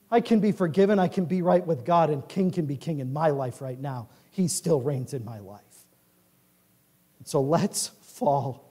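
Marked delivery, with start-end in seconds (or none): none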